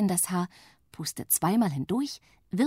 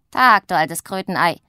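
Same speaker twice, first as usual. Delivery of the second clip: loud gruff witch greeting; calling out; 10 dB louder than usual. A narrator's reading. Witch Pumpernickel calls to her sister, loud greeting. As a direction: hollers a cheeky greeting. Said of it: "ruft Pumpernickel jetzt ihrer Schwester zu".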